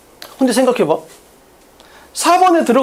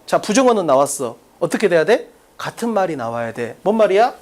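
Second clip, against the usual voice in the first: ordinary talk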